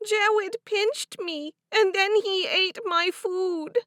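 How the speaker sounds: put-on very high, slow, tattling kid voice; whiny child. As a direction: complain like a little boy. Child complains to mother.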